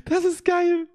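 laughing. Real, not acted. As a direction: crack up laughing. Laughing at the stickers.

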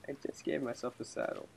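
very soft, voiceless whisper; hushed, barely audible; whispered aside. Real, not acted. Quiet aside while playing.

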